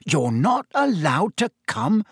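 stunned, stretch the question out; horrified. Acted. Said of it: "Ron looked horrified".

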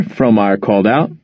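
plain reading voice

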